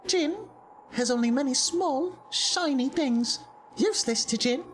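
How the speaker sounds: high-pitched, evenly held creature voice; Jin, bargaining creature. Acted. Jin asks a visitor for an offering, high stilted creature voice. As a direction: speak high and stilted, like an odd little trader.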